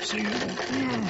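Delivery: rough, strained hoarse voice. Real, not acted. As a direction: voice giving out, hoarse. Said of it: "Lost my voice".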